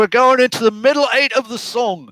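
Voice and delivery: a hint of a Londoner announcing the section; British accent impression. Drum teacher imitating Europeans naming the middle eight. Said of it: "They call it over that in Europe".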